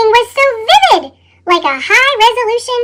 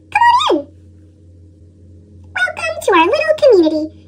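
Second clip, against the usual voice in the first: higher, bright storybook cookie voice; cheerful cookie narrator; 5 semitones higher than usual. Acted. Cookie greeting readers at the bakery, higher and cheery. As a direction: welcome the listener in as a friendly cookie.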